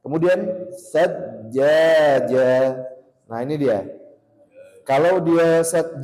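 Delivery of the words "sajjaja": very slow, loud sajjaja with the jj held; recitation with shadda. Shown as a demonstration. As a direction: hold the jj of sajjaja. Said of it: "harusnya waqaf di sini".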